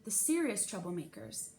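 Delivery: ordinary delivery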